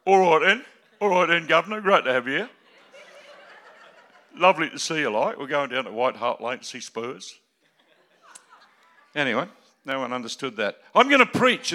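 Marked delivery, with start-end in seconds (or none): 0.1-7.4 put-on loud, jolly London Cockney accent; hammed-up Cockney greeting. Preacher joking in Cockney to English guest, loud. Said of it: "I say".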